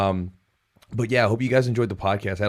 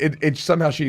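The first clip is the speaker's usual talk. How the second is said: usual speaking voice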